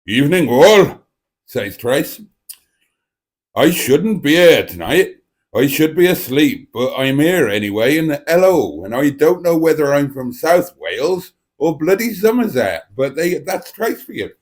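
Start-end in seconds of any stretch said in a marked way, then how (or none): 0.1-1.1 put-on loud West Country-Welsh mongrel accent; put-on South Wales or Somerset greeting, loud; Trace's voice. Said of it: "shall I do it in her voice". 3.6-12.8 put-on muddled South Wales-Somerset accent; imitating a weird Welsh-Somerset accent; Trace's voice. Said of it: "She's got a slightly weird accent".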